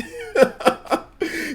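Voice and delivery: high-pitched cackling laugh; amused laugh at a hapless troll; real laugh. Real, not acted. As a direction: burst out laughing, high and staccato.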